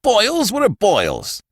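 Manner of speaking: high, incredulous outburst; exasperated retort. A narrator's reading. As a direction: throw the word back in outrage. Brewster scorns Archie's consolation.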